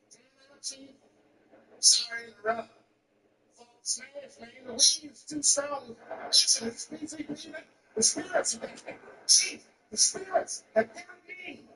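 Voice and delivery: high, drawn-out plea to the chief; hammed-up high voice, slowed by the weed; high-pitched drugged panic. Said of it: "I told the chief".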